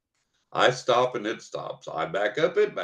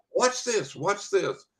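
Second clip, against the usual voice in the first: eager; 10 semitones higher, slower than usual. Retold or imitated. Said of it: "So I said".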